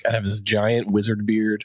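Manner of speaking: normal talking voice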